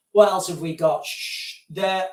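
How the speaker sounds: usual speaking voice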